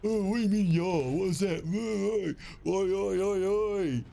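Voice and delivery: high, loud mock English teasing; mocking English friends. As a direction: ham up a jeering Brit mocking the ya. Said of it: "they were like".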